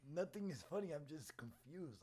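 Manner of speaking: high-pitched defensive denial; acted baffled protest; retold protest. Retold denial that anything is funny.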